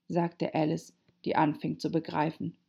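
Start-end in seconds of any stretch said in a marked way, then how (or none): none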